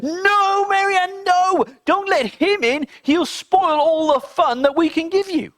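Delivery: high, loud pleading chorus of bad things; shrill high mischief voices. Bad things begging Mary Ann not to let the candle in, high and loud. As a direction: squeal it out like little troublemakers.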